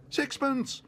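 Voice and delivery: very high, loud eager bid. A narrator's reading. Bear raises his bid. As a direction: pipe up keenly. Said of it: "said Paddington".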